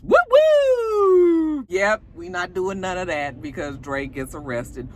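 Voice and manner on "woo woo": high, loud siren whoop; long, held high mock siren; imitated police siren. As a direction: wail "woo woo" like a police car. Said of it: "here come the police".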